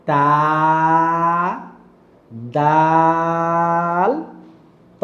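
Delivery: তা, দাল, ত each held long and steady; letter pronunciation demo. Shown as a demonstration. Teacher showing how the three Arabic letters are said, তা, দাল, ত drawn out. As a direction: draw out the vowels of তা, দাল, ত. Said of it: "কিভাবে দেখেন".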